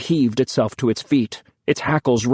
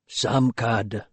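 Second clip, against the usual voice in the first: said softly; 3 semitones lower, 5 dB quieter than usual. A narrator's reading. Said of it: "Tambul said softly".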